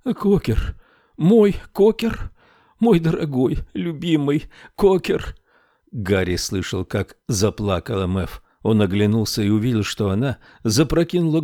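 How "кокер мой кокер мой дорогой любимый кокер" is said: high, tearful crooning of the name. A narrator's reading. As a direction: cry his name with love. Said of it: "По щекам её полились слёзы".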